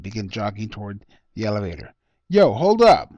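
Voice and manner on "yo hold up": high, loud shout to wait; calling out. A narrator's reading. Young man calls out loud for the elevator.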